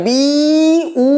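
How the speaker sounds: বি and উ each held long with a pause between; long বি, break, long উ; separated syllables. Shown as a demonstration. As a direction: stretch বি, stop, then stretch উ. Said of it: "তোমাকে থেমে উতে আসতে হবে".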